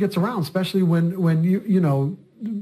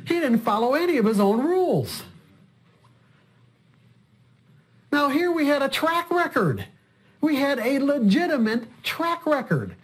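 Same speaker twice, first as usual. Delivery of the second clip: high-pitched incredulity, words drawn out; exasperated disbelief; 9 semitones higher than usual. Real, not acted. Coach marveling at trader who broke his own rules, exasperated. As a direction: pitch soars on each phrase, then drops away.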